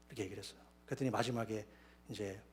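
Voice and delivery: normal talking voice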